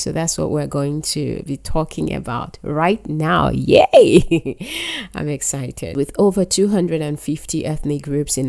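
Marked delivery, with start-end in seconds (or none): 3.5-4.6 loud, bursting cheer; excited yay. Real, not acted. Excited cheer before the topic. 4.6-5.1 short, high, bubbly giggle; happy laugh. Real, not acted. Laugh after cheering.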